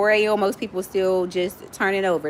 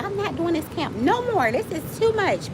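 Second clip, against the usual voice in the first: higher-pitched grumble, quoting herself; higher, imitated complaint; mock complaining; 10 semitones higher than usual. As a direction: pitch it up like a fed-up vow. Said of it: "I talk shit every year".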